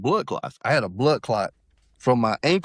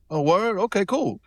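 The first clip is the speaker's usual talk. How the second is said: mock impressed; 10 semitones higher than usual. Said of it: "when people say".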